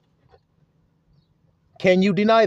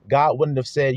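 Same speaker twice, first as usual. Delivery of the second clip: ordinary talk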